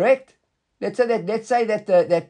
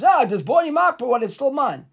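the adamant owner, pitched much higher; insistent claimant; 7 semitones higher than usual. Retold or imitated. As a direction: play the stubborn claimant. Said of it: "the guy says".

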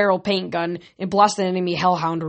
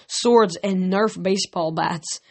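plain reading voice

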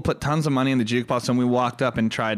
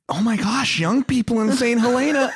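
mock surprised; 9 semitones higher than usual. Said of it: "and you're like".

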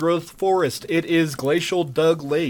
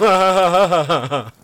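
laughing; 4 dB louder than usual. Real, not acted. Laughing after the Scottish bit.

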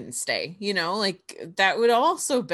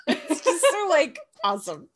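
laughing. Real, not acted. Laughing while gushing about line.

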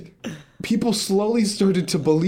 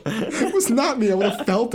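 higher-pitched, amused protest; laughing denial; 8 semitones higher than usual. Real, not acted. Storyteller rejecting the blame from friends, laughing denial. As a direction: deny it through a laugh.

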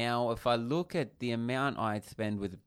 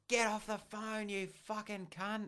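imitated angry yell; 11 semitones higher, 5 dB quieter, slower than usual. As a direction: act out a stranger yelling from afar, held back. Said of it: "He yells out and he goes".

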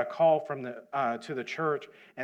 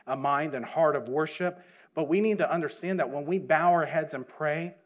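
preaching at full force; 3 semitones higher, 6 dB louder than usual. Real, not acted. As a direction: preach it out to the church, fervently.

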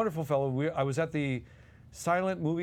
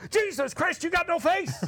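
much higher, louder, mock-panicked cry; Dom's shocked yell; 14 semitones higher, 4 dB louder than usual. Dom acting out the ruined baby Jesus, mock-panicked shout. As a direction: shriek it in comic horror. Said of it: "and Dom is working it".

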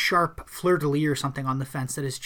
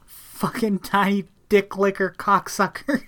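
mock rant; 5 semitones higher, 4 dB louder, slower than usual. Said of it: "she goes on quite a rant".

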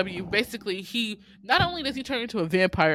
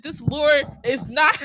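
higher, louder laughing exasperation; exasperated demand; 8 semitones higher, 7 dB louder than usual. Retold or imitated. Host ranting at the movie's lore, exasperated. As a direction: demand it make sense. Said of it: "And I said".

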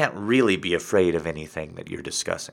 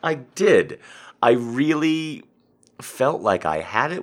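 emphatic; 6 semitones higher, 7 dB louder than usual. Real, not acted. Emphatic regretful insistence.

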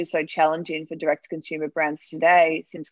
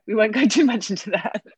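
laughing; 4 semitones higher, 4 dB louder than usual. Real, not acted. Laughs off a digression.

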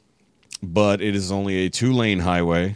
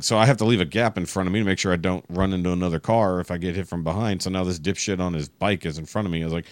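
usual speaking voice

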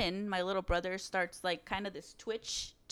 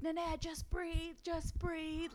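retold scream; 10 semitones higher, 7 dB quieter, much slower than usual. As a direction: plead with him to breathe, in a high, slow voice. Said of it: "like, screaming".

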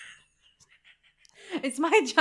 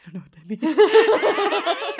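laughing confusion; 7 semitones higher, 8 dB louder, slower than usual. Retold or imitated. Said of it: "and I went".

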